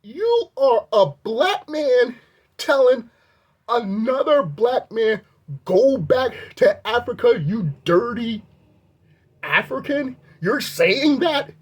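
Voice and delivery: high, slow, stunned rebuke; high, slow disbelieving challenge; incredulous. Retold or imitated. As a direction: spell out the absurdity in disbelief. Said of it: "I'm thinking".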